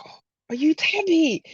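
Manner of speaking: hushed whispered question; whispering. Retold or imitated. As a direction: whisper it like a shy fan. Stranger recognises her quietly.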